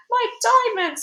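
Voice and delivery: very high, loud shriek; screaming. A narrator's reading. Duchess screams for her diamonds, very high and loud. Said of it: "screamed the Duchess".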